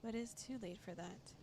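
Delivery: steady storyteller read of game text; story narration. Acted. Streamer narrating the game's second-person story. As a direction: read it like a dark fairy tale.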